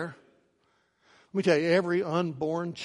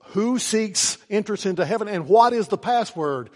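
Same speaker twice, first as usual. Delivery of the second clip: loud, raised voice from within the gates; booming gatekeeper's challenge; 7 semitones higher, 9 dB louder than usual. Acted. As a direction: demand it loudly, pitched high. Voice from heaven's gate challenges.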